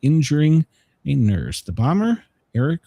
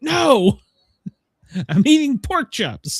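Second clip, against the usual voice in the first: higher, amused mock protest; retold indignant denial; 9 semitones higher, slower than usual. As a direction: deny it, laughing at your own story.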